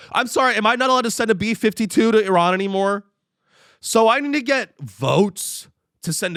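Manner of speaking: high, loud mock-innocent denial; sarcastic official spin. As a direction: play the official dodging the word war.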